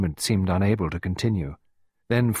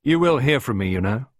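veiled threat, raised then dropping; almost menacing; 5 semitones higher, 5 dB louder than usual. A narrator's reading. Costello leaving Clarissa, almost menacing. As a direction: deliver a parting threat with mild menace. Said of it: "He sounded almost menacing".

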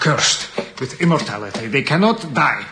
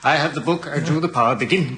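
ordinary talk

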